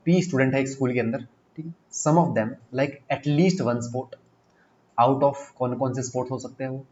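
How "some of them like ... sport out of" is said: reading the question out slowly; slow, deliberate question read. Shown as a demonstration. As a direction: dictate each word slowly. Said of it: "reads question slowly".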